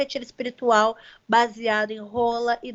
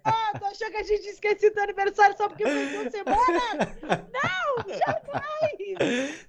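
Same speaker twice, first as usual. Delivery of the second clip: high laughing tease; 7 semitones higher than usual. Real, not acted. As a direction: tease him through laughter. Laughing teasing reveal.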